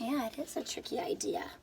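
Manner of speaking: soft unvoiced whisper. Real, not acted. Teacher agreeing with a child's reply, whispering. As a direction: whisper it to the class like a secret.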